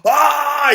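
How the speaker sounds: high, loud roaring yell; army roar. Retold or imitated. Troops roaring after the chant, yelling. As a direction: let out a mass war cry. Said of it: "Ils crient, quoi".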